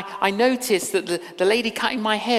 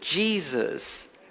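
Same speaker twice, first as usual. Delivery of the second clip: reverent hush; 5 semitones lower, 3 dB quieter, much slower than usual. Real, not acted. Preacher reverent repetition.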